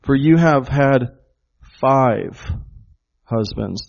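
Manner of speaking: "five" set off by pauses and punched; pause, then hit "five" firmly on a falling tone; stress on FIVE. Shown as a demonstration. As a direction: isolate "five" between two beats. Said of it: "The Greek emphasizes the word five".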